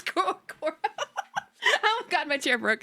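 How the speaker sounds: words through real giggles; high, laughing through the words. Real, not acted. Laughing as her chair breaks.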